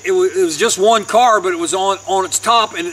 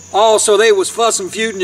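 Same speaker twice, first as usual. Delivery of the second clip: normal talking voice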